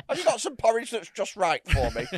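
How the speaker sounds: Goldilocks voice. Pretend. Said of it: "I sound like Goldilocks".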